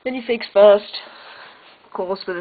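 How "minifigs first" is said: emphatic announcement. Acted. Announcing the minifigs section.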